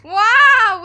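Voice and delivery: very high loud wail. A narrator's reading. Peter wailing after the slap, loud. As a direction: howl it out. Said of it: "wailed Peter".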